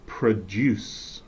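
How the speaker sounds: "produce" slowly as pruh-DOOSS; "produce" with weak first syllable, strong DOOSS; stress on second syllable. Shown as a demonstration. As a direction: stress the end of "produce", the verb form. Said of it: "There's a difference".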